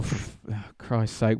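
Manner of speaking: soft, weary cursing under the breath; muttered exasperation. Retold or imitated. Driver to himself at the lights, exasperated mutter. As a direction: mutter it as a retold private grumble. Retelling his reaction to road rage.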